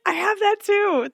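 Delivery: very high, hammed-up claim; Suki. Friend claiming every illness people have, hammed-up high voice. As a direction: pipe up in an exaggerated high voice. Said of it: "Being like".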